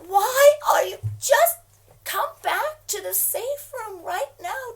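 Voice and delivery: high, loud desperate cry; frightened wife yelling down the line; frantic plea. Acted. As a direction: shout it in terror. Frantic calling to Yaakov.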